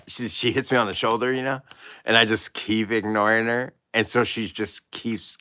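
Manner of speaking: ordinary talk, nothing marked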